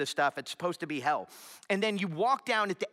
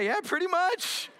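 laughing agreement; 12 semitones higher, 3 dB louder than usual. Retold or imitated. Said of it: "And I thought".

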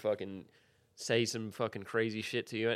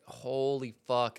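1 slow stunned reaction; much slower than usual. Retold or imitated. Reacting to a nasty DM.

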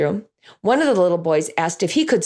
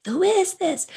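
put-on kids repeating; 11 semitones higher than usual. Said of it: "And they all say".

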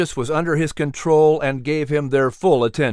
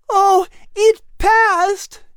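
very high, slow, louder cry of joy; overjoyed cry; 5 dB louder, much slower than usual. A narrator's reading. Liz cheering the passed resolution, overjoyed. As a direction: cry it out in delight. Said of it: "Liz exclaimed".

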